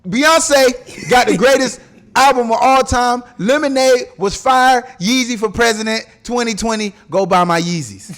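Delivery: [name] voice. Pretend. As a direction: grab the mic and hype it up. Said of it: "Give it [name]'s speech".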